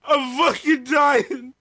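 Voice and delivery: slow, wheezing with laughter; dying of laughter, pleading; after laughing hard. Real, not acted. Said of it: "why is [name] laughing so hard".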